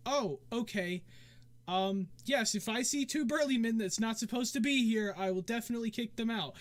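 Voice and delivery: high, eager hostess voice; obliging tavern dancer. Acted. Game master plays the dancer.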